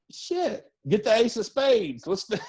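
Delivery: high, giddy cheer; mock celebration. A promoter after the sold-out show, high giddy celebrating. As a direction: pitch it high like popping bottles.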